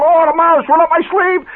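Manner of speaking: high loud hammed-up panic yell; mock yell. Father runs out flapping his arms, high loud mock yell. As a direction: scream it like the mouse is in your sleeve.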